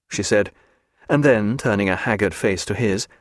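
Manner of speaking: ordinary talk, nothing marked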